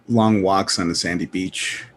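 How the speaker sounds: ordinary delivery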